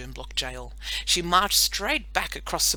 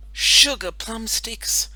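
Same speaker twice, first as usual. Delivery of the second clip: disappointed; 6 semitones higher, 7 dB louder, much slower than usual. Acted. Mild exasperated exclamation.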